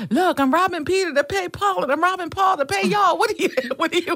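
mock-exasperated phone rant; stressed outburst. Stressed woman on the phone telling off a caller, exasperated. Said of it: "I was so stressed".

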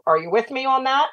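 ordinary delivery

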